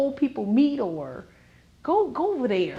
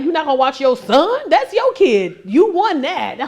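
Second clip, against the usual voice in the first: loud, higher retort with "son" punched; indignant comeback; 5 semitones higher, 7 dB louder than usual. Retold or imitated. Grandma turning son's guilt trip on him, mock indignant retort. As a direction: throw it back in mock indignation, hitting "son".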